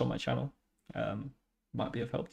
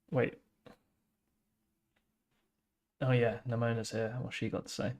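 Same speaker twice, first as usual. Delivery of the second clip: inner thought voiced; 6 dB quieter than usual. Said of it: "going from his own internal".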